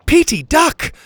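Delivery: high, loud warning; urgent shout. Acted. Pockets warning Petey before shooting, urgent shout. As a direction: shout for a friend to get down. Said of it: "Pockets shouted".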